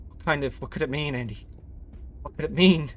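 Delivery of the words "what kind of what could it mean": ordinary talk, nothing marked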